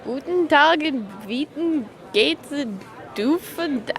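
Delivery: fake German. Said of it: "imitate German based on their impression".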